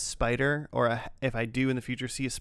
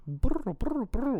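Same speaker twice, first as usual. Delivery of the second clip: cat trill; 12 semitones higher, much slower than usual. Retold or imitated. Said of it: "one of the cats was, like".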